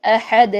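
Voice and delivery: احد with the final د bounced; bounced dal. Shown as a demonstration. Teacher correcting how احد ends. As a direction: let the دال ring out. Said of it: "احد کر کے بند نہیں".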